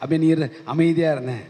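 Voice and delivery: ordinary delivery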